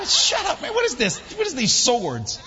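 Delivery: high, loud comic telling-off; retold exasperated shout. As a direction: shout them down in mock outrage. Retold telling-off during the wedding.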